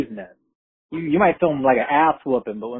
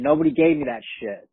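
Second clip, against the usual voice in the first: usual speaking voice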